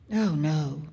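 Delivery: offhand, low dismissal; casual. A narrator's reading. Boy reassures his mother, casual. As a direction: brush it off lightly. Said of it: "said the boy casually".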